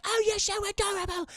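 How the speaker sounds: put-on high-pitched adoring child voice; cooing little girl. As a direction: gush over a cute pet like a little girl. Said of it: "She's like".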